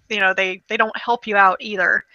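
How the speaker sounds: ordinary talk, nothing marked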